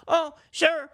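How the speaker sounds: high, groveling mock apology; simpering Tucker. Tucker grovelling to Trump in a high mock voice. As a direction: grovel to the boss in a high, pleading voice. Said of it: "And Tucker's like".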